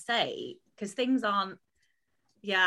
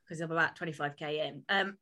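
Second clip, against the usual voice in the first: ordinary talk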